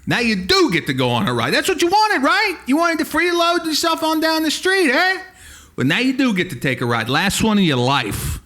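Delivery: loud, taunting hothead; mock menacing threat. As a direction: threaten a hitchhiker, hammed up. Acted threat to hitchhiking robot.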